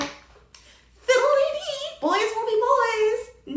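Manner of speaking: very high, slow, airy mockery; loud, drawn-out fake carefree lilt; mocking singsong. Reviewer mocking the non-resolution, sarcastic singsong.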